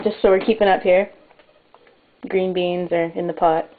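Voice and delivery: ordinary talk, nothing marked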